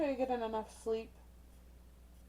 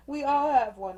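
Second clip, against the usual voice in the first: loud, higher, still cracking up; laughing; 4 semitones higher, 10 dB louder than usual. Real, not acted. Reader repeating grandmother's punchline to viewers, laughing.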